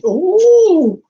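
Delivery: high, loud, drawn-out "ooh"; delighted ooh. Real, not acted. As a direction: whoop an admiring "ooh". Delighted by her tarot cards.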